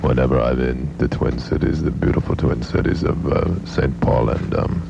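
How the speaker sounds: put-on low, droning German-accented statesman; Henry Kissinger. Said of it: "Henry Kissinger is with us".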